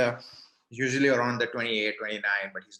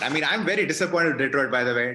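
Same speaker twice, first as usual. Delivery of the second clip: exasperated; 4 semitones higher, 7 dB louder, faster than usual. Real, not acted. Disappointed with Detroit rant.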